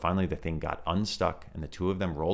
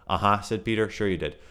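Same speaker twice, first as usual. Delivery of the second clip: sarcastic; 3 semitones higher, 5 dB louder than usual. Acted. Peter disbelieving.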